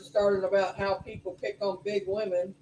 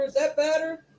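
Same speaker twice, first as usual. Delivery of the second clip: pushing the voice a bit louder; talking louder; 6 semitones higher, 3 dB louder than usual. Real, not acted. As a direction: speak up for the mic. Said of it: "I'll try to talk a little louder".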